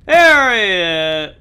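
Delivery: loud, slow, drawn-out call; excited shout. Real, not acted. Gamer spotting an enemy, calls out loudly. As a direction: shout it out as he appears.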